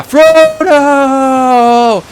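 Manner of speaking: very slow, high, loud wail of a name; slow-motion mock yell. Fan mocking everyone turning to Frodo, slow-motion yell. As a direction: stretch the name into melodramatic horror. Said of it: "in slow motion".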